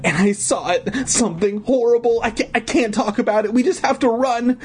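put-on high, frantic little-girl voice; panicked pleading. Frightened girl pleads to flee.

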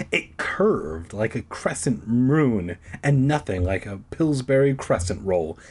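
usual speaking voice

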